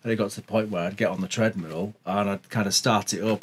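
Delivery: ordinary talk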